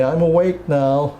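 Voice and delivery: father's reply. Retold or imitated. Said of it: "I would say".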